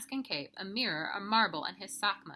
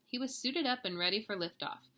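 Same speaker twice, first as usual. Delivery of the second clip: plain reading voice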